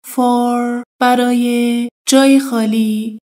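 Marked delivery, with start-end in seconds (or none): none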